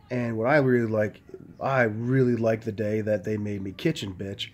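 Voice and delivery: ordinary delivery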